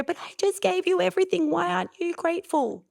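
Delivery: high, wounded mock-parent whine; hurt mum. Mum who gave everything asking why no gratitude, high and wounded. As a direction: plead like an offended mum. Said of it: "mum's gonna go".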